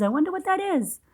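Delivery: softly wondering aloud; playful wondering. Acted. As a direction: tease the mystery with curiosity. Teasing mystery to child.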